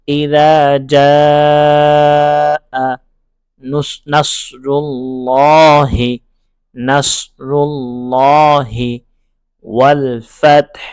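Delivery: each word chanted slowly and separately; slow, broken-up recitation, one word at a time. Shown as a demonstration. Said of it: "ভেঙে ভেঙে পড়ব".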